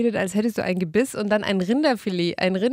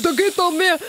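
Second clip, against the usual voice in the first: mock shout; 7 semitones higher, 4 dB louder than usual. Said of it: "brüllt einer".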